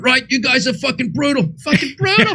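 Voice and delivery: high-pitched ranting comedian impression; high, put-on comic rant; Bill Burr. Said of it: "and I'm gonna do a Bill Burr".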